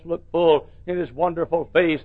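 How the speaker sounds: ordinary talk, nothing marked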